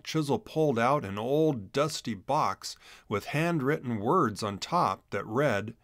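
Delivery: ordinary delivery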